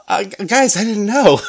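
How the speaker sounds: high, loud, laughing protest of innocence; sheepish protest. Retold or imitated. Officer pleading with the family, high laughing protest. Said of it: "And I just want to say".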